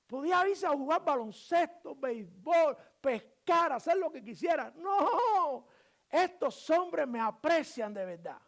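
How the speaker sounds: impassioned, high and loud sermon delivery; full-force preaching. Real, not acted. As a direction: drive each phrase home to the congregation.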